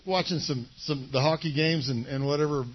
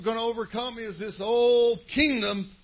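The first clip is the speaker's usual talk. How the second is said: ordinary talk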